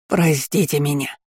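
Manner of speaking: usual speaking voice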